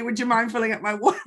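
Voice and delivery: ordinary delivery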